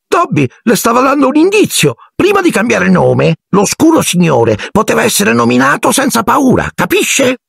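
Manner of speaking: high, squeaky elf explanation; Dobby, stating the obvious. A narrator's reading. Elf explains his clue to Harry, as if obvious. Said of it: "come se fosse la cosa più ovvia".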